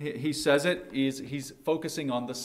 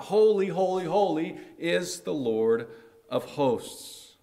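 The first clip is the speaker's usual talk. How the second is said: loud, raised cry of Holy, holy, fading by the end; shouting praise; 5 semitones higher, 4 dB louder than usual. Retold or imitated. Isaiah joining the seraphim's choir, shouting praise. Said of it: "and shout".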